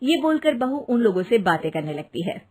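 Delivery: ordinary delivery, nothing marked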